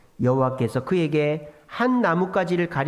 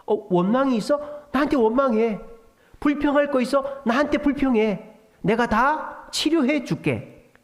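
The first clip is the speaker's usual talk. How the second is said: generous reassurance; 6 semitones higher than usual. Pretend. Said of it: "좀 더 안 좋게 얘기하면".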